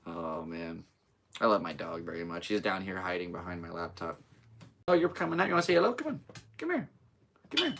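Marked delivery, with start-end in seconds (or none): 4.9-7.7 high-pitched coaxing pet talk; coaxing a dog. Real, not acted. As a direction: call a pet over in a high voice.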